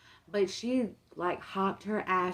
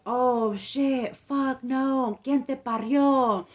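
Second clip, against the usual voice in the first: higher, slow cursing outburst, half English half Spanish; a hint of a startled uncle swearing; 5 semitones higher, 4 dB louder, much slower than usual. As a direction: blurt out the curses like a shocked uncle. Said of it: "I just remember him saying".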